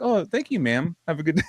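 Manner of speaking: high, polite phone voice; polite school caller. Retold or imitated. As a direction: imitate a courteous school secretary signing off.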